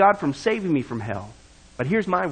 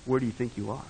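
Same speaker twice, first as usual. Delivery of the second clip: softer, hushed servant's question; quiet reply; 4 semitones lower, 8 dB quieter than usual. A narrator's reading. Servant answering the bored rich man, softly. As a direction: let it drop quietly, a chilling aside. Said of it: "replied quietly".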